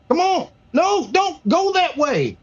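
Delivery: high, slow coaxing voice; coaxing the cat. Real, not acted. Streamer coaxing his cat to stay in the room, high and slow. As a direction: gently beg the cat not to run off.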